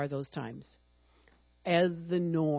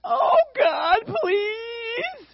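high, loud, wailing whiny plea; hammed-up crybaby begging God; mock whining; 9 dB louder, much slower than usual. Preacher mocking a whiny prayer, wailing high and loud. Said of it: "I don't do that through my whining".

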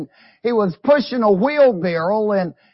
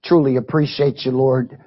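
prayer; 6 semitones lower than usual. Acted. Opening prayer to God.

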